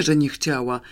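usual speaking voice

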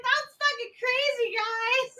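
put-on high, giddy party-girl voice; laughing giddily. Missy pulled from the water, laughing high and giddy. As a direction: squeal it through mock laughter. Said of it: "she's laughing as they're pulling her up".

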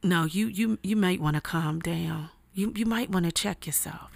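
quietly warn, as the friend did; hushed friend's warning. Retold or imitated. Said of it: "she was like".

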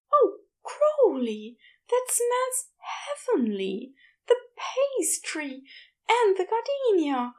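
Aziraphale thanking Crowley for gifts, delighted. A narrator's reading.

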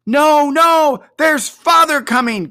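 put-on two excited kids yelling the news; high loud cheering children's cry. A narrator's reading. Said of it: "cried the two young Cratchits".

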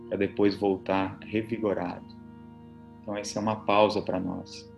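soft, low relaxation voice; soothing spa whisper. Acted. Leads guided relaxation over music.

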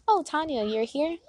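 high, bright fake-surprised hello; fake surprise. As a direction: act surprised to see her. Acted fake surprise greeting.

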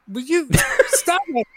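loud, high plea through laughter; laughing protest. Real, not acted. Guest tells teasing co-host to stop, laughing. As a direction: beg off, cracking up.

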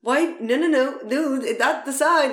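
mock outrage at the sign; exasperated rant. Tourist griping about the hidden tax, exasperated.

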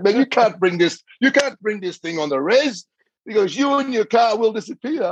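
high mock voice of the policeman; high-pitched joking threat from the cop; teasing cop. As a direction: rib him in a squeaky cop voice. Native cop teasing about the Fiat.

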